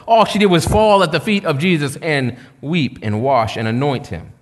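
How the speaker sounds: usual speaking voice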